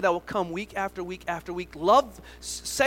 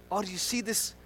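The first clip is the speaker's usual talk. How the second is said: ordinary talk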